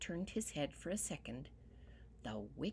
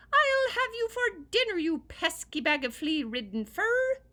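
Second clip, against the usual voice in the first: very high, loud tiny-man yell; 13 semitones higher, 12 dB louder than usual. Acted. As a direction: bellow down the hole at the rabbit. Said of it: "the little man yelled down the hole".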